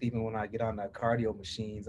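ordinary talk

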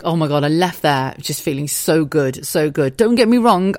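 normal talking voice

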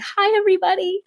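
bright, tender hello; sweet greeting. Retold or imitated. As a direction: greet your body like a room of friends. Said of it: "greet my body and say".

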